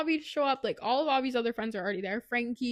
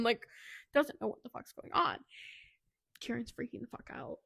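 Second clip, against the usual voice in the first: ordinary talk, nothing marked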